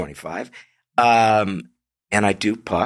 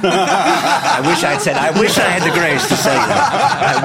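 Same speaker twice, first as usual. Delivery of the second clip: laughing through the words, slower; 7 semitones higher, 6 dB louder, slower than usual. Real, not acted. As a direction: giggles while saying it. Laughing while repeating a line.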